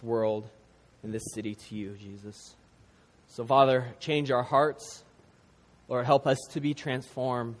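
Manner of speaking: low, soft praying voice; heartfelt prayer. Real, not acted. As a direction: pray gently on the congregation's behalf, pausing between petitions. Leads the congregation in prayer.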